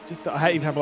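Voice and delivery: normal talking voice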